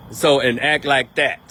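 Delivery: ordinary delivery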